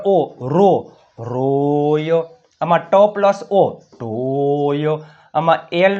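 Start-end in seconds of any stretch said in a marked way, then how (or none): 1.2-2.2 "રોય" with a very long o before the y; drawn-out blending. Shown as a demonstration. Teacher blends the word slowly. 3.8-5.0 "ટોય" with a very long o before the y; drawn-out blending. Shown as a demonstration. Teacher blends the word slowly.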